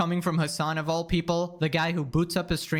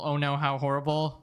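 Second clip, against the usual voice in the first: monotone voice; 3 semitones lower than usual. Retold or imitated. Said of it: "In the most monotone voice imaginable".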